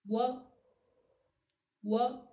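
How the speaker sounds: U and O glided as wo; wo as one sound. Shown as a demonstration. Teacher modelling wo for learners as one diphthong. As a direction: glide W straight into O. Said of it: "turns into a W sound".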